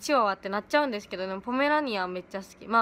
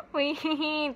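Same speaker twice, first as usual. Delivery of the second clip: Minami impression; 5 semitones higher, slower than usual. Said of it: "南くん".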